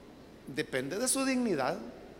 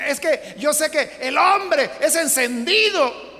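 louder, slower mocking man's excuse; 5 semitones higher, 8 dB louder, slower than usual. Preacher mocking husbands who excuse infidelity. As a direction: ham up the lame excuse.

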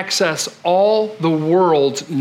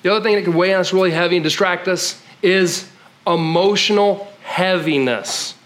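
ordinary talk, nothing marked

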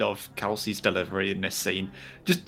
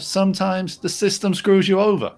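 higher, offhand shrug of a line; a dad brushing off his son's bad luck, lightly mimicked; imitating Martin; 8 semitones higher than usual. Podcast host quoting Martin to his co-host, a light mock dad voice. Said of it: "he's just like".

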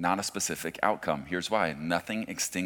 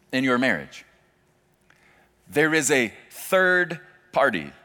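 much higher and louder, climbing sermon peak; preaching at full force; 8 semitones higher, 9 dB louder than usual. Real, not acted. Pastor driving his point home to the congregation, full preaching force. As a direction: preach it out to the whole room.

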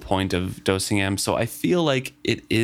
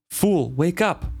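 higher, drawn-out call to wake someone; understated cry, pitched up and slowed; 7 semitones higher, slower than usual. Acted. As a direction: cry out to rouse a sleeper, with restraint. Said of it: "I cried out to him".

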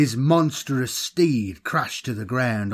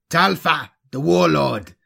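a louder, contemptuous answer; sneering; 4 semitones higher, 5 dB louder than usual. A narrator's reading. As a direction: sneer the name and title. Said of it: "the other sneered".

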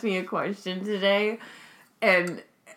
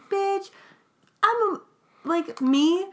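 indignant; 12 semitones higher than usual. Pretend. Indignant mom answering her kids.

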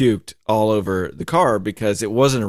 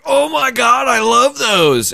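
much higher, louder gushing wife voice, slowed; wife impression; 6 dB louder, slower than usual. Imitating his wife gushing over candy in a high, loud voice. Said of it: "Sounded just like her".

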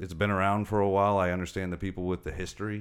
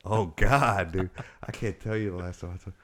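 ordinary talk

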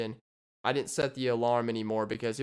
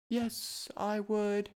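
mock buyer; 8 semitones higher, 4 dB quieter, much slower than usual. Acting the buyer.

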